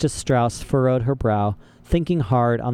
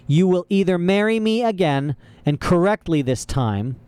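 higher-pitched villain issuing an ultimatum; menacing Count Olaf; 6 semitones higher than usual. A narrator's reading. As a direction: threaten with emphatic insistence.

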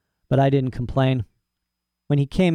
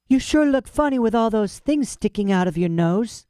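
sly cat voice; 9 semitones higher than usual. Acted. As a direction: purr it out like a smug barn cat. Cat character teases.

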